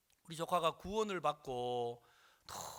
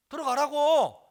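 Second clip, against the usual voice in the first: loud, high shouted command; insistent order; 9 semitones higher, 14 dB louder than usual. Retold or imitated. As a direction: order him inside forcefully. Retold quarrel, pushing father in.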